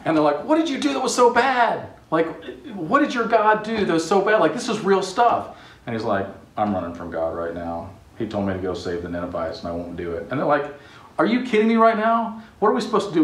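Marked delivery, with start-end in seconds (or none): 0.4-5.6 incredulous sailors. Acted. Sailors panicking at Jonah. 6.6-10.3 sheepish confession. Acted. Jonah confessing to the sailors. 11.2-13.2 exasperated sailors. Acted. Sailors panicking at Jonah.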